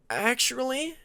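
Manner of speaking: high, small squeak of a word; nervous squeak. A narrator's reading. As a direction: force it out as a timid squeak. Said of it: "Peacemaker managed to squeak".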